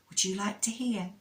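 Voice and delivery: ordinary delivery, nothing marked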